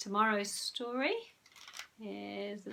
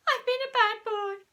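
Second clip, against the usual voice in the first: weeping; 13 semitones higher, 9 dB louder than usual. A narrator's reading. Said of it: "wept Peter".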